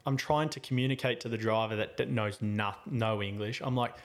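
ordinary talk, nothing marked

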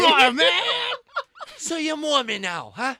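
high, loud scolding mom; angry Brooklyn mom. Retold or imitated. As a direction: yell like a furious Brooklyn mother. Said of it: "my Brooklyn mom".

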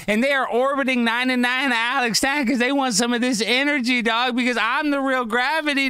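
high, hyped-up hype-man energy. Real, not acted. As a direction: pump up the crowd, full of hype. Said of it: "I just get hyped up. I'm just excited".

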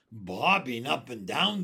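loud, incredulous echo; crying out. A narrator's reading. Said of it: "they cried".

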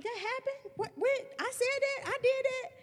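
high, incredulous questions; disbelief. Retold or imitated. Mocking her own memory gaps, high and incredulous. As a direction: act stunned at your own forgotten past. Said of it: "I'm like".

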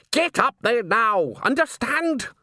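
high, slow, forceful command; exasperated order. A narrator's reading. Salzella losing patience with the mob, exasperated.

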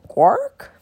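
sharp bird cry; raven croak. A narrator's reading. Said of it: "he croaked".

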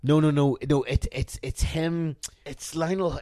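ordinary talk, nothing marked

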